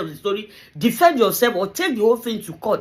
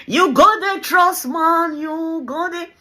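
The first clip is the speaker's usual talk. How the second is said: teasing taunt; 7 semitones higher, slower than usual. Acted. Taunting the wife.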